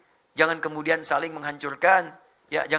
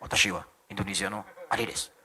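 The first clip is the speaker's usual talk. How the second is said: low, softer Japanese-style self-introduction; mimicked Japanese accent; 9 semitones lower, 5 dB quieter than usual. Indonesian introducing himself in Japanese, low Japanese accent. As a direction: drop low like a Japanese speaker. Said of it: "kayak orang Jepang".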